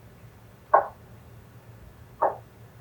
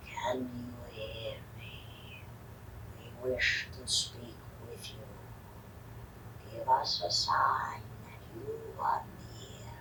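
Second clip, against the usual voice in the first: whispering; 5 semitones lower, 12 dB quieter than usual. Acted. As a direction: breathe it into the dark. Séance summoning whisper.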